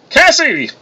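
high, booming call of a name; bellow. A narrator's reading. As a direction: holler it across a bar. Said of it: "cried in a familiar bellow".

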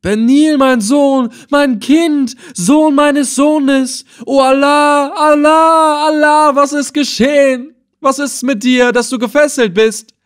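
very high, loud anguished wail; screaming out. A narrator's reading. Old man seeing his grandson in chains, screaming. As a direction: wail each cry long at the top of the voice. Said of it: "schrie auf".

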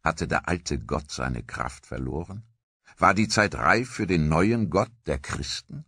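ordinary delivery, nothing marked

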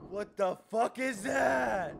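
loud, slow, drawn-out disbelief; startled shout. Real, not acted. Player spots a strange creature, startled shout. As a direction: shout it out, stretching the words.

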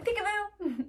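high bright peekaboo shout; spring it on them high; playful peekaboo. Pretend. Peekaboo after song.

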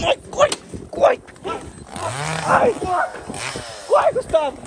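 high, loud terrified yells; panicked screaming. Real, not acted. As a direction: scream at him to run. Monster chasing, yelling run.

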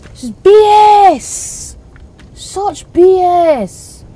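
very slow, loud, high stretched complaint; drawn-out rage. Real, not acted. Gamer fuming at the unfair fight, stretched-out loud yell. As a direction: drag every word out in frustration. Raging at the game.

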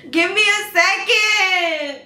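loud, slow, tearful wail; fake sobbing. Girlfriend fake crying behind locked door. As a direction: sob it out like you're heartbroken.

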